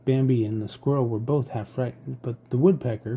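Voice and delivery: usual speaking voice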